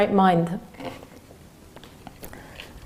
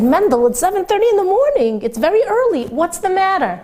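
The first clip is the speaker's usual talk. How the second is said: a higher, surprised woman at the intercom; startled woman asking what's wrong; woman's voice, higher; 8 semitones higher than usual. Retold or imitated. Woman answering the intercom at seven thirty, higher and surprised.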